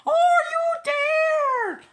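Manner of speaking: high, loud, slow call. Acted. Bear calling into clover for the speck. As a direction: call out across the field. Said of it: "and called".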